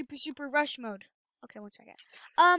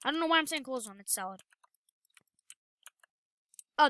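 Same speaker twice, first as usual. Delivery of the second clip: usual speaking voice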